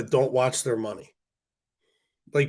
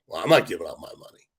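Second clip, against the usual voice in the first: mock rich man; 7 semitones lower, faster than usual. Rich man refusing to pay.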